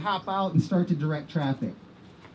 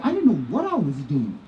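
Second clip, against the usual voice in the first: usual speaking voice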